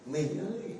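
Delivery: "Mình ơi" said softly with a gentle rising "ơi"; soft tender call. Shown as a demonstration. A priest showing how close a couple sounds, softly. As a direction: murmur it close, like to a spouse. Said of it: "Đây, nó gần chưa?".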